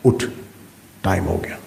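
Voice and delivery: a low, soft inner voice urging; lightly voiced conscience, low and soft. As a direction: murmur it like a voice inside. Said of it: "پہلی آواز اندر سے آئی".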